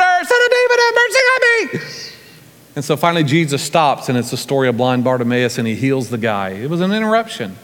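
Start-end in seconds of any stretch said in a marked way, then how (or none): 0.0-1.8 very high, desperate scream for mercy; imitated louder scream. Blind man refusing to be silenced by the crowd, screaming. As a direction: scream the plea at the top of your pitch. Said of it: "he just screams even louder".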